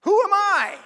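loud, high-pitched protest; incredulous outcry. Acted. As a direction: cry it out in dramatic disbelief. Acting out Moses's startled question.

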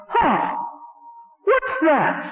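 a hint of a drunk woman scoffing; mildly drunken scoff. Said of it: "in a very alcoholic voice".

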